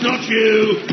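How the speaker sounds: ordinary talk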